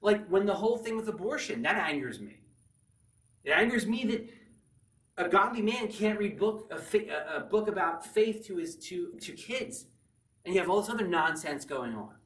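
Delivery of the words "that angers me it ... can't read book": ordinary talk, nothing marked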